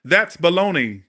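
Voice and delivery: loud slow scoff; indignant. Real, not acted. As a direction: call it out, exasperated. Indignant outburst at narcissist behaviour.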